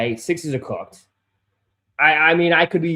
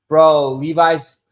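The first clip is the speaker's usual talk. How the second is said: ordinary delivery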